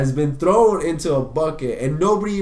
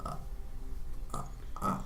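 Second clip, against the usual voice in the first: trembling in fear; 7 semitones lower, 14 dB quieter than usual. Retold or imitated. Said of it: "trembling in fear".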